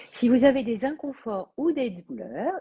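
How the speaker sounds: ordinary talk, nothing marked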